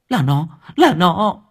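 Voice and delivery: loud, high cry; desperate outcry. Acted. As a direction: burst out in despair. Lán cries out in frustration. (Lán uất ức kêu to)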